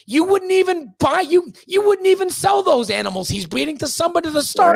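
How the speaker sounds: high, loud angry rant; shouting in exasperation; heated shout. Real, not acted. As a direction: let the frustration burst out. Heated rant about bad breeding.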